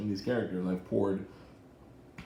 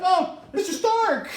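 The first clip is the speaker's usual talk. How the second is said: high, slow laughing cry of Mister Stark; giddy boy greeting his mentor, laughing; high laughing Spidey; 5 dB louder, slower than usual. Retold or imitated. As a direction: squeal it like an excited kid. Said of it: "They're like".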